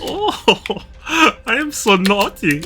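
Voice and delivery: high, teasing and coy; coy mock voice. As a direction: confess it like a cheeky cartoon. Playful mock confession.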